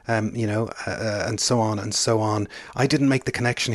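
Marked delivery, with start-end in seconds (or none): none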